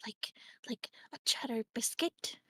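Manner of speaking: quick unvoiced whisper. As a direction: whisper it fast. Whispered question.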